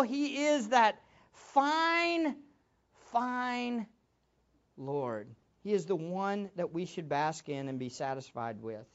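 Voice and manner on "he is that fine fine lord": voice breaking with emotion. Real, not acted. Preacher moved praising the Lord.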